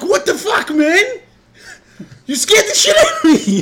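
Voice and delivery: high, loud laughing complaint; cracking up while complaining; laughing outrage. Retold or imitated. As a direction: laugh through the indignation. Laughing at the mix-up.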